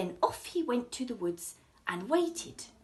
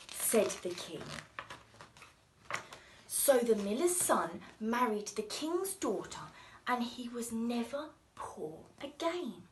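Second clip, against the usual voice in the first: ordinary delivery, nothing marked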